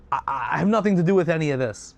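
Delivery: fast, stammering denial; terrified. Acted. Third son disowning it all before the king, terrified. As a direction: stammer and rush to get clear of blame.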